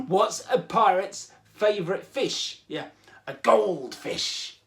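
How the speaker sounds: slow, hammy pirate joke-telling; terrible pirate accent. Pretend. Host telling the fish joke, terrible pirate accent. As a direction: drag the punchline out like a pirate. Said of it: "my terrible pirate accent".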